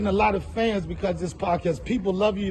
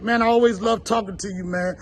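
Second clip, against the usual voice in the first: normal talking voice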